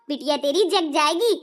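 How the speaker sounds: ordinary delivery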